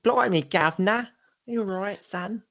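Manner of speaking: high, bright Cockney Bert voice; cheeky Mary Poppins Cockney; Cockney accent. Pretend. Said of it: "some Bert stuff".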